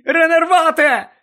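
loud, high cast of the spell; shouted spell. Acted. Harry casting a spell on Dumbledore, shouting. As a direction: fire the spell off at full voice. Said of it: "крикнув він".

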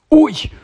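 sudden high-pitched "ow"; startled yelp of pain. A narrator's reading. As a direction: yelp as if jabbed from behind. Ron gets poked in the head.

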